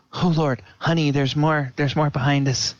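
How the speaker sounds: urgent call over his shoulder; alarmed Kuth. Acted. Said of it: "he says".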